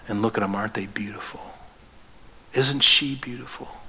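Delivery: hushed, breathless whisper of wonder; awed whisper. Acted. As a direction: whisper it as if showing a treasure. Jesus showing bride to Father.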